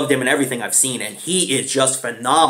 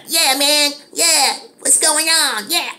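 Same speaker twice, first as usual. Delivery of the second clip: ski mask friend impression; 13 semitones higher, slower than usual. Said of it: "And he's like".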